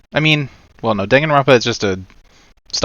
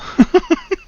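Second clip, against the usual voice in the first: very high squealing laugh; cartoon laugh. Real, not acted. Laughing at penis joke.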